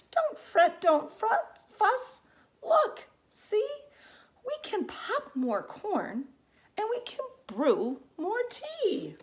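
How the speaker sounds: high, loud, fussing mouse voice; squeaky mouse. Acted. Little mouse comforts the crying bear in a high squeak. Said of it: "Mouse squeaks".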